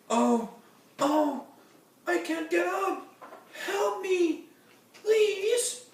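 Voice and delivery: very high, loud, hammed-up wail for help. Fallen aunt wailing for help, hammed-up. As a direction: cry out like a fallen diva. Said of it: "she wailed".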